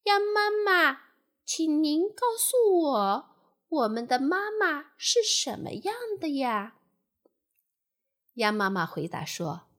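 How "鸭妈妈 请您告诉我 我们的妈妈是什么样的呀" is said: high-pitched little tadpole asking; 小蝌蚪. A narrator's reading. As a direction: ask politely as a high-voiced baby tadpole. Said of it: "于是他们问鸭妈妈". (小蝌蚪高声礼貌地问)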